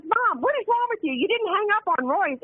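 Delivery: high, exasperated daughter voice; high-pitched exasperated scold. Retold or imitated. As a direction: scold mom from the passenger seat. Tipsy daughter scolding mom.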